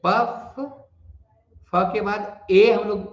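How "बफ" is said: बफ higher and punched, as the stressed part; stressed first syllable. Shown as a demonstration. Teacher isolates stressed बफ for learners, punched. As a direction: hit बफ hard and short. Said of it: "पहला syllable पर ही stress है".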